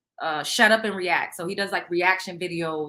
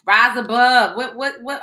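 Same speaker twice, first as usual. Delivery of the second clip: ordinary talk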